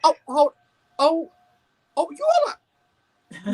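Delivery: high, slow, drawn-out disbelief; stunned disbelief. Retold or imitated. Watching the trailer, she retells her dawning horror, stunned. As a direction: let each oh hang in shock. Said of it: "and then I was like".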